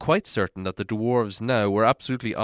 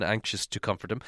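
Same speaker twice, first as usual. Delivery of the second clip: usual speaking voice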